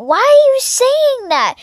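high-pitched play protest; mock exasperation. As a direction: demand an answer in a high voice. Plushie exasperated by constant meowing.

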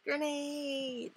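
high-pitched, drawn-out warning cry; alarmed call. Acted. Soldier warning squad of a grenade, alarmed call. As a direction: call out a mock battlefield warning.

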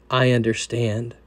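soft, low and calm; gentle reply. Retold or imitated. Jesus gently reassuring the anxious sinner, soft and low. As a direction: answer quietly, with tender reassurance.

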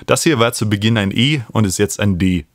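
ordinary talk

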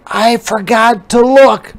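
high, loud spider voice; panicked spider. A narrator's reading. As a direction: call out while sinking. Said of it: "yelled the spider".

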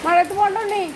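high sing-song pet talk. Real, not acted. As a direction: coax the dog gently in a high voice. Comforting sad dog in rain.

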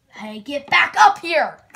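loud commanding yell; shout. Acted. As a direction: call them back sharply. Yelling at character.

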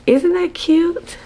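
high, loud squeal of delight; delighted gushing. Real, not acted. Vlogger showing off her anniversary shirt design, delighted gushing. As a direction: gush over something adorable.

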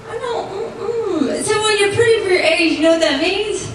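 ordinary talk

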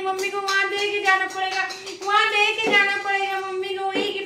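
high, slow cooing coax. Real, not acted. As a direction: sweet-talk the puppy into handing it over. Coaxing the dog to give.

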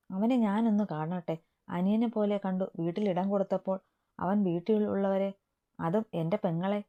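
usual speaking voice

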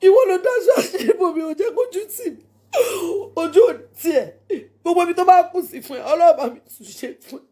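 tearful thanks. Real, not acted. Thanking her friend Ìyábọ̀, laughing and crying at once. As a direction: gush thanks while fighting back crying. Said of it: "I don't want to cry".

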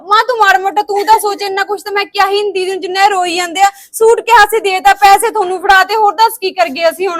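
shouting, exasperated defence; angry shouting. Acted. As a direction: yell back in outrage at the blame. Furious at being blamed.

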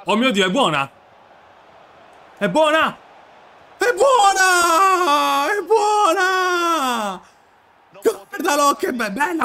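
building to a high, long screamed celebration; goal scream. Real, not acted. Gamer celebrating his goal, screaming with joy.

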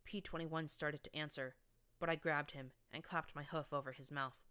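plain reading voice

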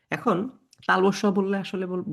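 normal talking voice

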